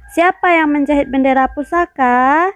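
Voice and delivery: ordinary talk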